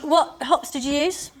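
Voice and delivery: ordinary delivery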